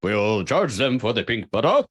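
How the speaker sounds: mid-Atlantic radio announcer voice; old-time newsreel accent, half British; 1950s narrator. Shown as a demonstration. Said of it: "nineteen fifties radio fucking voice".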